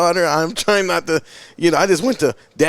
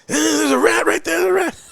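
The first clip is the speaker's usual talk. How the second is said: panicked; 11 semitones higher than usual. Retold or imitated. Calling wife from shower top.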